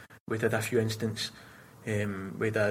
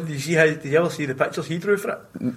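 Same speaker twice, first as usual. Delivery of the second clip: ordinary talk, nothing marked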